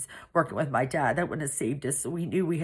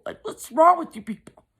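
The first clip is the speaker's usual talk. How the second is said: much higher and louder, cried out in disbelief; exasperated outcry; 12 semitones higher, 6 dB louder than usual. Retold or imitated. Narrator cries out at her cruel family in loud disbelief. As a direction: throw the question out in outrage.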